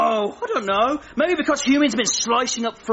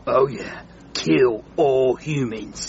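much lower, slow menace; slow villain vow; 8 semitones lower, slower than usual. Acted. Frogger vows revenge, slow low menace. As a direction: say it slowly, like a villain.

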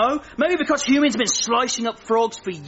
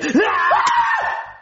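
evil cackle. Acted. Frog gloats after killing the chef, evil cackle. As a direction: cackle in triumph.

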